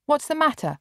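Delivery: put-on a boy demanding the truth from his sister. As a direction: demand an answer sharply. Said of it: "Mark demanded".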